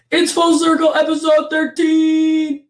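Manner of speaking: loud, high, drawn-out announcer intro; redone hype intro. Acted. Host restarting the intro for listeners, big announcer voice. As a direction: sell the show name big and slow. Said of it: "Let me try that again".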